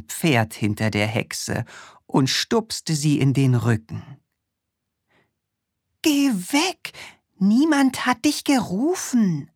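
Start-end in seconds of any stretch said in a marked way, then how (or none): 6.0-9.5 high, cross witch shooing away. Acted. The witch shoos the horse away, high and cross. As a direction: shoo the horse like an irritated witch. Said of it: "rief die Hexe".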